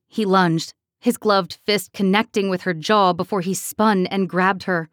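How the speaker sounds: ordinary delivery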